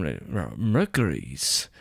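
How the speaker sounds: put-on Russell Crowe's Greek accent. Mimicking the actor's Greek accent for a podcast cohost. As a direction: drag out [name] in a mock Greek accent. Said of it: "he talks like this in".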